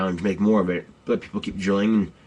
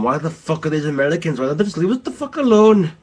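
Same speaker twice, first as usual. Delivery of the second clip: mock angry yell, rising high and loud at the end; pissed off locals; 6 semitones higher, 4 dB louder than usual. Locals fed up with American troops, mock angry yell. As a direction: rant it like a furious local telling the Americans to go. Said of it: "a bunch of pissed off people going".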